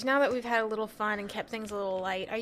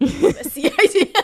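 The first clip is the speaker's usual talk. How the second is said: much louder, slow, with a laugh; loud repeat; 5 semitones higher, 17 dB louder, slower than usual. Acted. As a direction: shout it back, laughing, slowly. Echoes the joke loudly.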